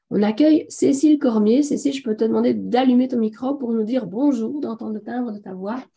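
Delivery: usual speaking voice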